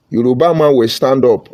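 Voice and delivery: ordinary talk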